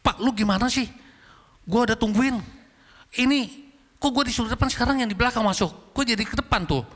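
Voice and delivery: high-pitched indignant telling-off, not shouted; retold angry scolding. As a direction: imitate yourself telling off the parking attendant. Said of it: "Saya marahin".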